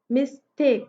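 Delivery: "Mistake" broken into two beats, मिस, टेक; a small gap inside "mistake" after मिस; split into syllables. Shown as a demonstration. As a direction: sound out both syllables of "mistake". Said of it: "miss and take".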